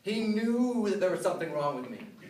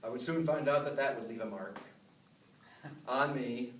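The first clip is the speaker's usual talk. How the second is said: ordinary delivery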